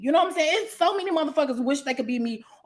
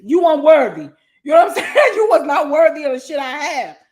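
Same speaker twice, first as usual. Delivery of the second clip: louder, with a laugh in the middle; laughing shout; 3 semitones higher, 9 dB louder than usual. Retold or imitated. Telling haters they are unworthy, in a loud laughing shout. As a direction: shout it down at them, half laughing.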